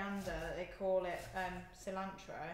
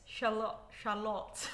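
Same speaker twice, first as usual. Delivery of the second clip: ordinary talk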